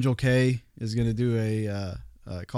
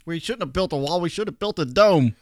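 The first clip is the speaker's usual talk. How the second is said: high mock chant, louder on dome; mock protest shout; 7 semitones higher, 5 dB louder than usual. Protesters mocked shouting about a dome, high and loud. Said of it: "they're gonna scream".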